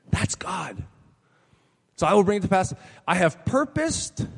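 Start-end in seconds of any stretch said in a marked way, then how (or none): 0.1-0.8 hushed, breathy awe; whisper. Real, not acted. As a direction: drop to a whisper for "That's God". Awed hush after declaration.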